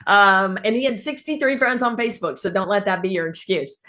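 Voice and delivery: usual speaking voice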